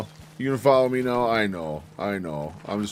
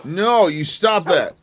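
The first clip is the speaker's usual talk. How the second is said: scolding the dog; 5 semitones higher, 6 dB louder, slower than usual. Real, not acted.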